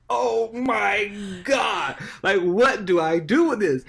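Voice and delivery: overwhelmed, barely believing the news; stunned excitement. Retold or imitated. Reading the nomination email, slow stunned excitement.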